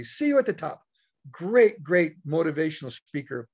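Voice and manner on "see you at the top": Ziglar's catchphrase said higher and bright; upbeat catchphrase. Retold or imitated. Speaker quoting Zig Ziglar to the audience, upbeat catchphrase. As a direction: hand it out like a motivational signoff. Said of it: "Zig Ziglar always say".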